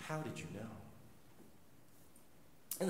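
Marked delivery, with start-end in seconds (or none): 0.1-0.7 soft, stunned question; a quiet, stunned man asking how he knew; hushed amazement. Retold or imitated. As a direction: ask it quietly, in awe. Shocked Russian man.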